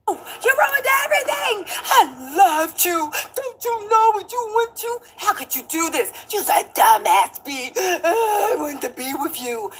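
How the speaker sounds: high, cracking fake sob; hammed-up weeping rant; mock wailing. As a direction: wail it like a crying man. Mimicking [name]'s crying rant.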